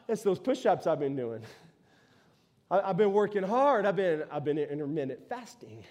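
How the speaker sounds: smug muscle-head boasting to himself; a hint of a proud gym bro. Samson crediting his push-ups, lightly voiced smug gym bro. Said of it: "He thought".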